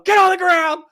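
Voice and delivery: high-pitched, loud order; yelled command. Retold or imitated. Retelling a cop's order to the suspect, yelling. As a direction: shout him down to the ground. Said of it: "So I yell at him".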